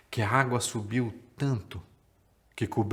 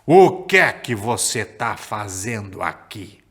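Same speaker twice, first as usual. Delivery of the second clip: lower, louder, slow booming giant; 4 semitones lower, 6 dB louder, slower than usual. Acted. Giants questioning the little boy below, slow booming voice. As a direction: boom it down slowly from far above. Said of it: "olharam pra mulher lá embaixo e falaram".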